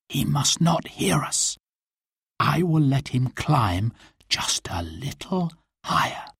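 hushed, secretive plotting; conspiratorial whisper, spaced out at the end. A narrator's reading. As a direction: whisper so he won't hear. Robin whispers to avoid being heard.

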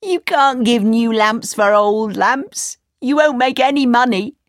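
lightly voiced scoffing crowd; mocking townsfolk. A narrator's reading. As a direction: scoff at his foolish trade. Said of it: "they cried".

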